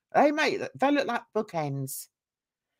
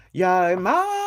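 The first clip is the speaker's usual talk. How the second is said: loud call to someone absent; 4 semitones lower, 5 dB louder than usual. Pretend. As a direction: shout the name out like summoning him. Calling out for missing YouTuber.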